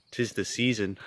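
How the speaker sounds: ordinary talk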